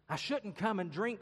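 put-on high, loud girlish worry; anxious, high-pitched protest; frightened girl. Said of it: "said Jill".

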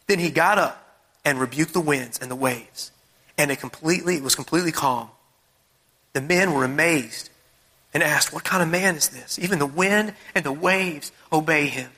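low, measured Bible reading; scripture reading. Real, not acted. Preacher reading how Jesus calmed the storm, measured. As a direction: read it out low and calm.

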